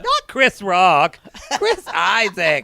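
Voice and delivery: loud, slow, laughing correction; exasperated correction. Real, not acted. Correcting Siri on the name, laughing exasperation. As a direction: enunciate it loudly through laughter.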